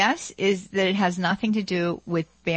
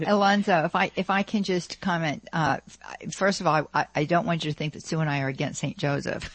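ordinary talk, nothing marked

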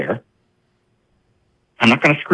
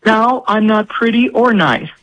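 high, pleading exasperated mother; frazzled mom confessing; 10 semitones higher than usual. Retold or imitated. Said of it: "Go to confession".

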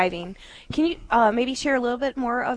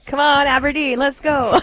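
louder, higher call to the listeners; rallying cheer; 4 semitones higher, 9 dB louder than usual. Real, not acted. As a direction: pump up the Aberdeen crowd. Calling on Aberdeen listeners to donate.

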